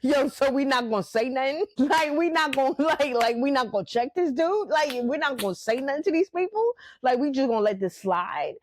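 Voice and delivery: an inner hothead pushing to act; giggling, riled-up inner voice; body's restless inner voice. Retold or imitated. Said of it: "my body's like".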